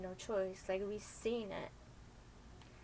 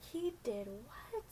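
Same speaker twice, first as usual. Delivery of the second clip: slow stunned question; 3 semitones higher, much slower than usual. Retold or imitated. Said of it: "her anxiety was through the roof".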